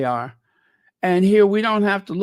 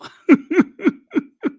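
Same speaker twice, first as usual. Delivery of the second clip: light, high staccato laugh; high giggle; 13 semitones higher, 5 dB louder than usual. Real, not acted. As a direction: giggle in a run of short high bursts. Laughs after saying I don't know.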